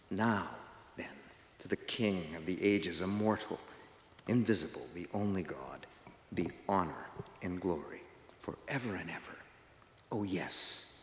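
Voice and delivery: low, hushed doxology; closing prayer. Real, not acted. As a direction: let the blessing settle softly and low.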